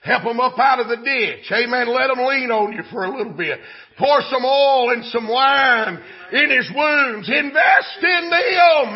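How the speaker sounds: loud, rising sermon cry; full-force preaching. Real, not acted. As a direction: preach it with long, drawn-out stresses.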